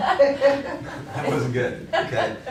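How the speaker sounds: said high through laughter, slowly; laughing. Real, not acted. Trainer to the workshop, speaking through laughter. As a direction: still laughing at the memory.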